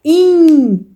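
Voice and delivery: IN held long and slow; drawn-out nasal sound. Shown as a demonstration. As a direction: let the nasal IN ring out. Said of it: "perceber esse in".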